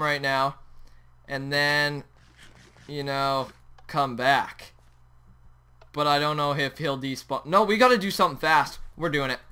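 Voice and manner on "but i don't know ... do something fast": normal talking voice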